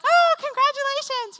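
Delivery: high, loud acted cheer; imitated race volunteer gushing; gushing congratulations. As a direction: greet a finisher with over-the-top excitement. Acts out finish-line greeter congratulating her.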